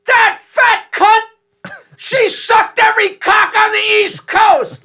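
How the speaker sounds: high, loud, slow yelled heckle; heckler shout. Retold or imitated. Ringside heckler yelling at the announcer, loud and high. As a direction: bellow it from ringside. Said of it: "the guy ringside goes".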